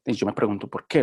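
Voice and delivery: ordinary talk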